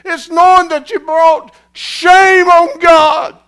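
loud, high impassioned preaching, building; full-force preaching. Real, not acted.

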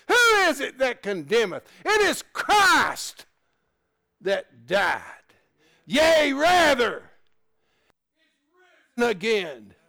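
loud, high and drawn-out shout, slow; preaching shout. Acted. Preacher shouting that Christ is risen. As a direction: stretch out Christ and rather in a ringing cry.

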